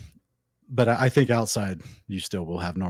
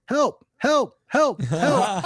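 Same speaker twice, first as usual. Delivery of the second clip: much higher, louder yelping cries; yelping dog cries; 5 dB louder, much slower than usual. Retold or imitated. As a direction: yelp help like a panicked little dog. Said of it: "what do you think a Chinese dog sounds like".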